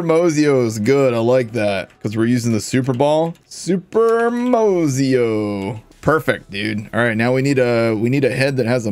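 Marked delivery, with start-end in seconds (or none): 3.5-5.8 very slow, higher, stretched out; grand name reveal. Pretend. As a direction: draw out the new name like an announcer. Announces the new name.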